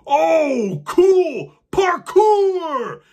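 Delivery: high, loud, gleeful giant cheer; excited giant. Acted. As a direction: shout it like a thrilled big kid. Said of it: "the giant's booming voice".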